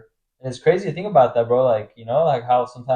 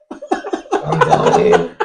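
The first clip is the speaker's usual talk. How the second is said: much higher, laughing mock whine of a drunk friend; mock dying whine. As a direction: squeak it out like a friend sure he's dying. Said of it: "starts going".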